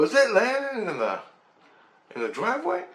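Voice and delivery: high-pitched disbelieving cry; re-enacted astonishment, pitch leaping up; incredulous question. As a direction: squeak it out in mock alarm. Acting out own alarmed disbelief.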